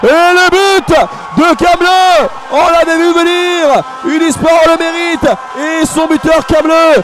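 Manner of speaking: loud, high goal shout; excited sports commentary. Real, not acted. Goal call.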